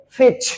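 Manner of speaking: "फिच" said with no t sound before the ch; silent t. Shown as a demonstration. Teacher shows students the t is silent, "फिच" without a t. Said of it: "टी नहीं बोलते हैं".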